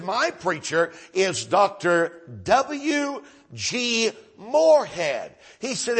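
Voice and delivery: slow and loud, savoring each name; proud emphasis. Retold or imitated. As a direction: announce his preacher's name with slow pride. Proud old man naming his preacher.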